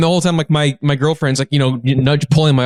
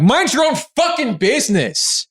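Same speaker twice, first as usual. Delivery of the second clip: held-back mock shout, pitched up; retold yell; 10 semitones higher than usual. Voter yelling back at the heckler, retold yell. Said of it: "yelled back".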